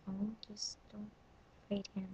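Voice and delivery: breathy whisper, asking him not to fight; whispered plea. Retold or imitated. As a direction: whisper it so only he hears. Quiet answer to boyfriend.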